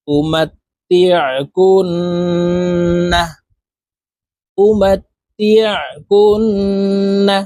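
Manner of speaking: slow chant, long nasal n in কুন before releasing না; nasal ghunna. Shown as a demonstration. Teacher reciting the whole word twice, nasal ghunna. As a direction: hum through the nose on কুন, then a short না. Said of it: "নাকের বাসি থেকে গুন্নাহ করে পড়ব".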